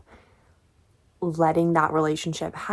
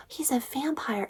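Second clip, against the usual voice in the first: gasped whisper, higher and soft; hushed realization; 6 semitones higher, 8 dB quieter than usual. Retold or imitated. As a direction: whisper it like a startling discovery. Acts out Bella's startled discovery.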